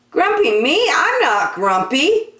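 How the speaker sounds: high, loud denial; insisting monkey. Acted. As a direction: Jim stamps out his protest. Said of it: "said Jim".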